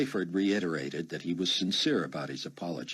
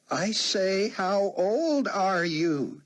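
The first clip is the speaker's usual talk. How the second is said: high, slow, drawn-out call; shouting as if to the deaf; 6 dB louder, much slower than usual. A narrator's reading. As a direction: stretch every word for a deaf old man. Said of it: "she shouted, as if talking to a deaf old man".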